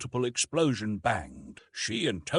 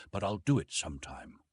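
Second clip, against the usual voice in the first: usual speaking voice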